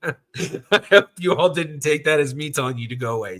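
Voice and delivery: laughing through an apology; real laughter after an accident. Real, not acted. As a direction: chuckle at your own slip while you talk. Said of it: "I accidentally hit Siri".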